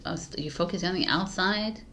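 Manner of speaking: ordinary talk, nothing marked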